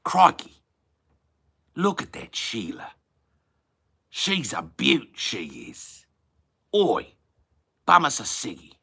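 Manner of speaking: high, punchy broad Aussie exclamations; Australian accent. Shown as a demonstration. Aussie admiring a Sheila and cadging a ciggy, Australian accent. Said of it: "a certain kind of Australian".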